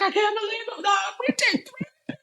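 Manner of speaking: very high, squeaky mock crying; imitated happy crying. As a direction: wail it way up high, imitating tearful disbelief. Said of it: "she was crying".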